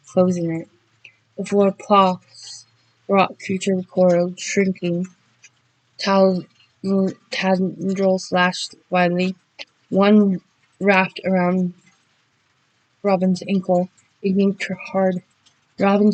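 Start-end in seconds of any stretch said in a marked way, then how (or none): none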